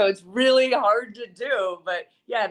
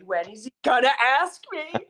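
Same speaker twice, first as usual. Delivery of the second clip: higher, playfully exasperated whine; mock impatience; 6 semitones higher than usual. Guest joking about waiting for an invite, mock impatience. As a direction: ask it in mock longing.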